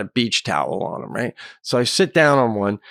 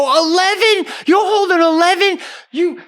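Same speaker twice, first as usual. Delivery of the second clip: high, loud rant slowing into a drawn-out insult; furious screaming; 4 dB louder, slower than usual. Retold or imitated. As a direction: scream in outrage, stretching the last words. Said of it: "And I'm screaming at him".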